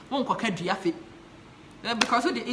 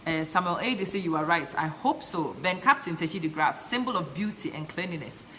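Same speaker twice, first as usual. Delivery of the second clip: ordinary talk, nothing marked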